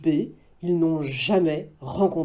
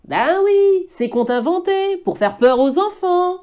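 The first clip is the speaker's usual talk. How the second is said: put-on much higher child voice, lively; wide-eyed and eager; boy's voice; 12 semitones higher, 5 dB louder than usual. Boy talks with the wolf, high child voice. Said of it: "explique l'enfant".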